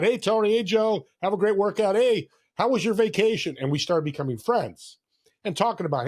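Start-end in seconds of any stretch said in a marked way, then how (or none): 0.0-3.6 high, upbeat front-desk greetings to regulars; cheerful greeting. Retold or imitated. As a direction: call out friendly hellos, pitched high. Acting out greeting gym clients.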